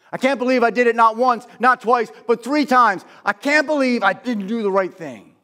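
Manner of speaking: high, loud burst of regret; anguished self-reproach. Acted. Peter counting his three denials, anguished outburst. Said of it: "I can imagine he's sitting there saying".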